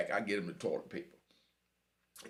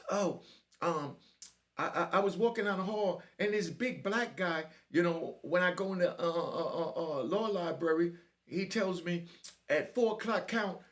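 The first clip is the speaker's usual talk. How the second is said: high, hesitant mimicry of a squeaky kid; squeaky little voice; 7 semitones higher than usual. Chubby young inmate explaining why he's back, squeaky voice. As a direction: squeak it out, stammering slightly. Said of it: "his little squeaky ass voice".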